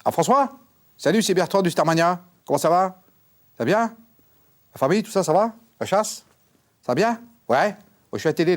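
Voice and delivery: high, loud matey phone voice; chummy phone chat. Acted. Bertrand chats with François Ier on the phone, high and matey. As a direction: chat up the king like an old pal.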